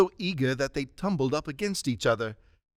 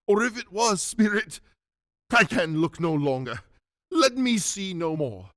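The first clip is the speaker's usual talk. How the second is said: pleading, pitched up at first; anguished plea; 5 semitones higher than usual. Acted. Scrooge begging the Spirit to end the vision, anguished. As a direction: beg desperately to be spared.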